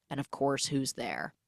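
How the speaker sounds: ordinary delivery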